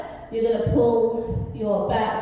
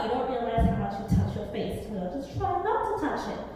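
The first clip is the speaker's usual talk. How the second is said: ordinary talk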